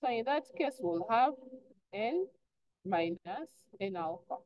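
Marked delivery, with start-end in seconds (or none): none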